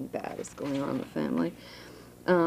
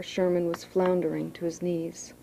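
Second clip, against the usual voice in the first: ordinary delivery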